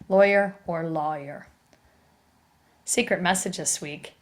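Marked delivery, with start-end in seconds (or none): 0.1-1.5 "lawyer" first as LOY-er, then as LAW-yer; oi version, then aw version. Shown as a demonstration. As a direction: contrast the two "lawyer" readings. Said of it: "Either way is considered phonetically correct".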